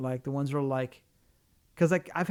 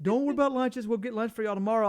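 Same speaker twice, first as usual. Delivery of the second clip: mock upbeat coworker; 5 semitones higher, 4 dB louder than usual. Coworkers reassuring about lunch.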